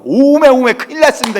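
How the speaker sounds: loud, high outcry in Korean dialect; panicked regional dialect. Retold or imitated. Frightened servant lapses into native speech, panicked Korean dialect. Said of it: "사투리가 나와요".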